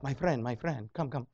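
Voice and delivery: mock Israeli mall seller accent, soft and coaxing; hushed, lightly put-on Israeli accent luring a shopper; a hint of a pushy Israeli kiosk seller accent; bad Israeli accent. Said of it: "It's a bad Israeli accent".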